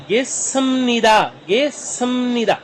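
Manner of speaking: गिसमनिदा with the s sound, slowly; pronunciation demo. Shown as a demonstration. Teacher shows how गिसमनिदा is pronounced. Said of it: "इसलिए इसको मैं बोल रहा हूँ".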